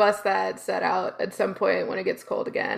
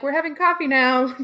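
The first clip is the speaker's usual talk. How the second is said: raised, louder voice of a parent taking charge; urgent parent; 5 semitones higher, 5 dB louder than usual. Retold or imitated. Parents steering kids away from a yelling man, urgent and insistent.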